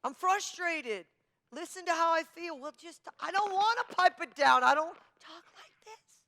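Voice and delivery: frustrated. Retold or imitated. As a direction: whine that you can't pipe it down. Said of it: "I'm like".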